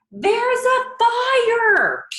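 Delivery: very high, loud, slow alarm shout; big yell. Shown as a demonstration. Teacher acts out the man yelling the fire warning, very high and loud. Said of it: "Let's look at what the man yelled".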